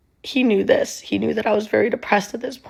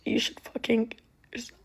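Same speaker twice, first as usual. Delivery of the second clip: a cold, voiceless whisper; whispered quote; 3 semitones higher, 9 dB quieter than usual. Retold or imitated. Boyfriend's cruel line retold in a whisper.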